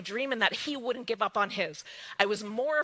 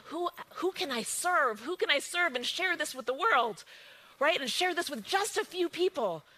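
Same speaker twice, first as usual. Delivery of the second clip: usual speaking voice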